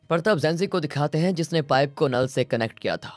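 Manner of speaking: usual speaking voice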